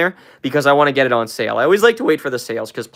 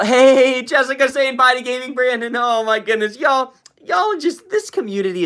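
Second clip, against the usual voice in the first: high, delighted burst at the chat; excited; 10 semitones higher than usual. Real, not acted. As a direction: squeal with joy over the chat.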